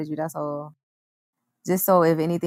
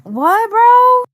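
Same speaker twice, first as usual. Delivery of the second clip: incredulous; 14 semitones higher, 5 dB louder than usual. Real, not acted. Incredulous outburst.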